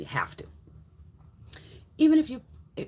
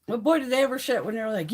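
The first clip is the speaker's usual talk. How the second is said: ordinary delivery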